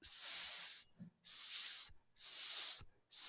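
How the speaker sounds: "স" as separate long hisses; S sound drill. Shown as a demonstration. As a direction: repeat "স" as a sustained sss, pausing between. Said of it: "এরকম উচ্চারণ হবে".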